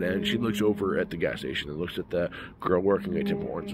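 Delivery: ordinary delivery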